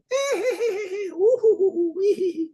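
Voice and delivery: long, very high whooping cackle; imitated laughing. Laughter heard on the phone video, imitated in a high cackle. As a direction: mimic shrieky laughter, going from giggle to whoops. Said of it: "I hear in the thing".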